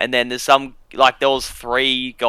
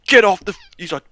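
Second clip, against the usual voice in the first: sharp barked order, higher and louder; cop yelling; 9 semitones higher, 7 dB louder, faster than usual. Retold or imitated. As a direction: bark it like an angry cop. Cop yelling at scooter riders.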